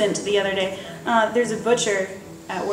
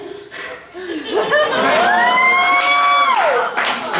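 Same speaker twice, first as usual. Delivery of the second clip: big laugh. Real, not acted. Laughing at own punchline.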